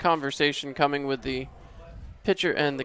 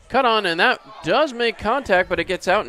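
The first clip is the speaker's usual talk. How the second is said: higher, louder burst on contact; excited play-by-play; 5 semitones higher, 4 dB louder than usual. Real, not acted. Announcer calls a swing making contact, excited play-by-play. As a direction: call the contact with a jump in pitch and volume. Live call of a hit ball.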